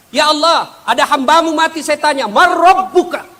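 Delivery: high, loud excited angel report. Acted. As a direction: rush up to report to God. (malaikat melapor dengan suara tinggi dan keras)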